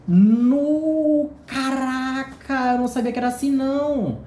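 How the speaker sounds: high, loud gasp of wonder that stretches out caraca; amazed outburst. Real, not acted. Unboxing the Clarice Lispector book for his viewers, loud amazed high outburst. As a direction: blurt it out as the book is unwrapped, real surprise.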